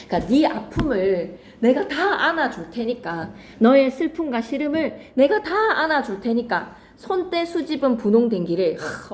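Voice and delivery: ordinary delivery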